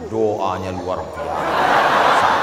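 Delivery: low, very slow, amazed praise; a villager praising the prayer; awed villager. Retold or imitated. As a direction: drawl out the wonder, deep and slow. Villager in awe.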